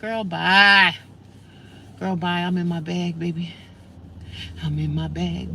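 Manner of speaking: ordinary delivery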